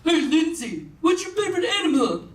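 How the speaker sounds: put-on chirp of a dumb question; mimicked questioner. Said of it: "they'd be like".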